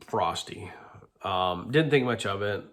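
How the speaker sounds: ordinary talk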